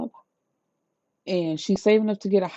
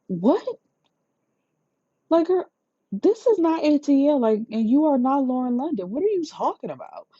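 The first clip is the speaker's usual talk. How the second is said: incredulous scolding; 7 semitones higher than usual. Real, not acted. Vlogger scolding the character, incredulous.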